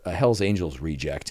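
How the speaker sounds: ordinary talk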